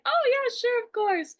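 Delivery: Ken agreeing. Retold or imitated. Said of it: "And he was like".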